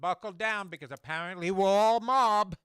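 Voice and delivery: loud, high, hammed-up indignation; mock outrage. Co-host jokes Italians are all mob, mock outraged.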